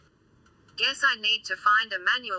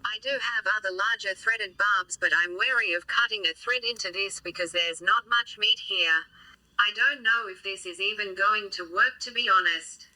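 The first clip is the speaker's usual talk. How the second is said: robot voice. Real, not acted. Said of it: "you're gonna have to put up with my robot voice for a bit".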